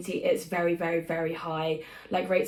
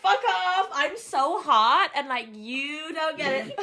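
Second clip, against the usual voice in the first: sassy brag; 7 semitones higher, slower than usual. Real, not acted. Playful bragging.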